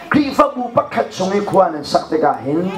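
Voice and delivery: ordinary talk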